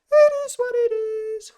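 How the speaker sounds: mock high squeak of the catchphrase; squeaky falsetto catchphrase. Vlogger to viewers, catchphrase in a mock high squeak. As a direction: squeak it out like a joke.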